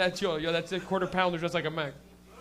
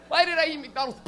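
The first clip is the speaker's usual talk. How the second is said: higher, laughing groan of regret; mock regret; 6 semitones higher than usual. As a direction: moan it like you instantly regret it.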